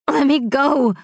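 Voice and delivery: a grieving brother shouting to be released; raging yell. Acted. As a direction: fight to break free with your voice. Victor struggling in Marcus's grip.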